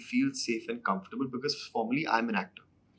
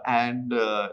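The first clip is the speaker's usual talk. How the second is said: ordinary delivery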